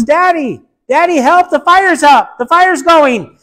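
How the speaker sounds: put-on high, loud, pleading little girl; frightened girl. Trapped daughter calling to her father, high and pleading. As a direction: beg your daddy for help.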